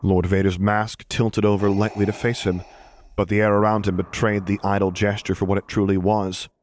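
plain reading voice

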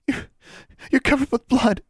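high, stammering alarm; very high, horrified exclamation. A narrator's reading. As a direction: stumble on the first word in shock. Friend sees blood on her.

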